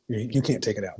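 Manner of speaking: normal talking voice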